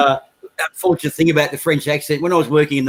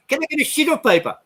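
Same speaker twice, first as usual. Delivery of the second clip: put-on high French accent with "sheet" said like "shit"; 13 semitones higher than usual. Thierry asking for paper in a high French accent. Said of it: "French crazy bastards".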